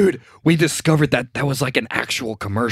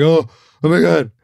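mildly giddy, slightly raised voice; tickled dad; 4 semitones higher, 5 dB louder than usual. Retold or imitated. Dad coming home to mom, tickled. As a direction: imitate a dad tickled by a joke. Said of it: "just tickled by it".

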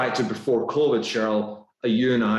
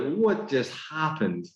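higher, slow disbelief at himself; retold astonishment; 7 semitones higher, slower than usual. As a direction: imitate your own baffled reaction, drawn out. Retold shock at his own words.

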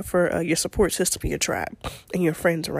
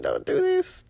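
mock pleading; 13 semitones higher than usual. Son triggers mom guilt.

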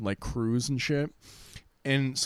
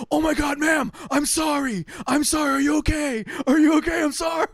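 high, frantic, pleading yelps; freaking-out apology shouted high; panicked apology; 12 semitones higher than usual. Retold or imitated. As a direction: panic after hitting someone, pitch way up. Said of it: "he starts freaking out".

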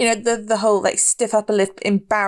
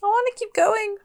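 put-on scared little kid; 13 semitones higher than usual. Player voices scared child Sally.

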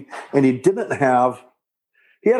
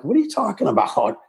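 baffled retort; 13 semitones higher than usual. Retold or imitated. Retold puzzled reply on the phone.

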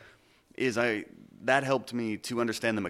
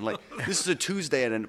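ordinary delivery

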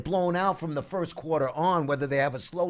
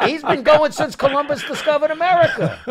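loud, high-pitched joking outburst; raised voice, incredulous and joking; teasing exaggeration; 12 semitones higher, 7 dB louder than usual. Real, not acted. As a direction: crow the ribbing over the laughter. Joking exaggeration teasing a longtime fan.

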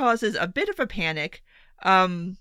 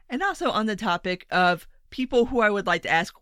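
ordinary talk

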